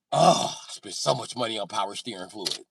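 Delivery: ordinary talk, nothing marked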